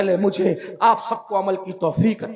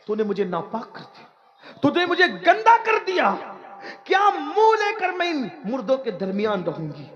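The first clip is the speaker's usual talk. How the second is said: put-on a young woman crying out in outrage, pitched up on the first word; raised, accusing cry of a violated girl; girl's anguished cry; 7 semitones higher than usual. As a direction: cry out at him, wronged and disgraced. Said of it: "اس نے چیخ ماری".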